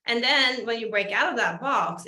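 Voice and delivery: normal talking voice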